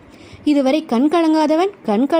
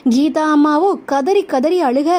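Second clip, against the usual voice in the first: usual speaking voice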